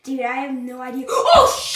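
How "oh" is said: high, loud frightened yelp; real scared shriek; startled shriek. Real, not acted. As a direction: yelp with a jolt of fear. Frightened in the dark.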